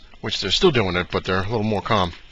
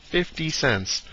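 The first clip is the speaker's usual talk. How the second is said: quiet, higher echo of the price; hushed, incredulous repeat; stunned disbelief; 5 semitones higher, 6 dB quieter, much slower than usual. Real, not acted. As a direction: repeat it softly, not believing it.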